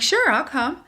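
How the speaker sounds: bright eager agreement, voice jumping high and loud; eager yes. Retold or imitated. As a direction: say yes like a thrilled kid. Eager yes retold to boyfriend.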